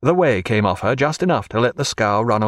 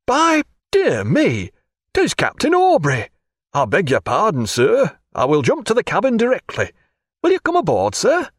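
a surprised officer grinning as he recognizes the captain; delighted officer; 9 semitones higher than usual. Acted. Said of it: "Then a huge grin, and Falconer cried".

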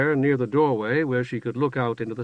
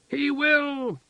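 put-on very high, slow delighted childlike voice; joyful high cry. A narrator's reading. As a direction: squeal it with glee. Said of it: "the woman shrieked, joyful".

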